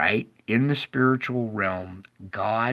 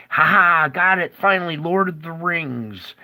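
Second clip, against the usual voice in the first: louder, higher mock triumph; hammed-up villain cheer; gloating devil; 5 semitones higher, 5 dB louder than usual. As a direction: crow over the prize like a cartoon villain. Satan gloating over the ring.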